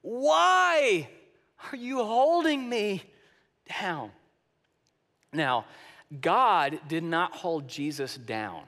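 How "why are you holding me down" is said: high, drawn-out, pleading child's wail, acted; imitated crying child. A baby to daddy during shots, mock anguished plea. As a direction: plead like a hurt child, swelling on the second why. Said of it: "they're just crying, and the, it's almost like they're saying".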